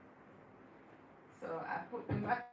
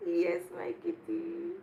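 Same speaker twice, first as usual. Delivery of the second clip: cooing to the cat; 7 semitones higher than usual. Real, not acted. Praising her kitty, a slow high coo.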